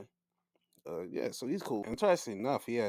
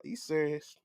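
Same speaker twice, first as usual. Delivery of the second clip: incredulous disbelief; 10 semitones higher than usual. Retold or imitated. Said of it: "He looked at him like".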